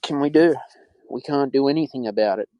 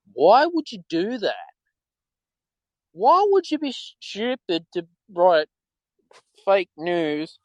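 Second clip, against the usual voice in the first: indignant; 5 semitones higher than usual. Real, not acted. Indignant rant about fake news.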